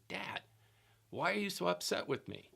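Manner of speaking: a hint of a puzzled little girl asking dad; young daughter. Daughter at the table asking dad, a lightly voiced puzzled girl.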